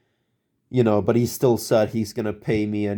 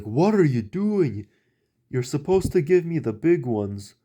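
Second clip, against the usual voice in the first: imitating an angry boss; 6 semitones higher than usual. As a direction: drag it out like an annoyed boss. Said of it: "Then he got mad again".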